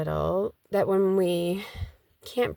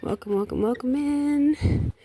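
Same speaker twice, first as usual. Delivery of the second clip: playful sing-song welcome; 8 semitones higher than usual. Pretend. Playful repeated greeting.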